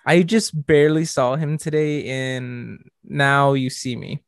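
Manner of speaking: ordinary delivery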